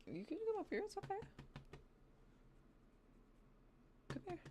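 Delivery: high, soft coaxing voice for a pet; pet-talk voice. Real, not acted. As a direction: coax the pet up gently.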